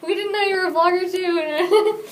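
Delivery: high, loud, laughing apology. Retold or imitated. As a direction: gush sorry through laughter. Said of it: "I'm like".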